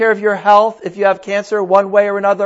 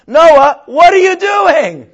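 loud, high, slow "what are you doing"; neighbors shouting over; 7 semitones higher, 7 dB louder, slower than usual. Acted. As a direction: neighbors yelling at Noah from next door. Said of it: "the conversation with the neighbors".

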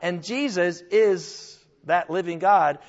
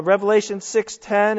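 ordinary talk

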